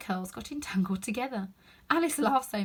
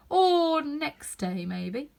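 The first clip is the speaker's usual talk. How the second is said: slow, loud, unbothered footman; deadpan footman ignoring the crash; same stolid tone; 6 semitones higher, 7 dB louder, much slower than usual. A narrator's reading. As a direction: carry on as if nothing happened. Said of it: "continued in the same tone".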